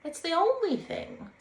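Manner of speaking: slow, high, loud grave pronouncement; solemn. Acted. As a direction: declare it as sacred truth. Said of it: "said the water rat solemnly".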